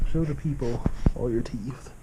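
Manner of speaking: ordinary talk, nothing marked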